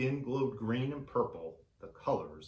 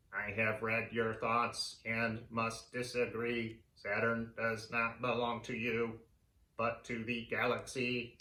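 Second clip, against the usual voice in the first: alien voice. Acted. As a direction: speak like a calm being from another world. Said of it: "His voice sounded next to me".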